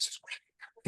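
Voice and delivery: breathy hushed whisper, no voice at all. Retold or imitated. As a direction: whisper it so the child won't hear. Mom whispers during child's session.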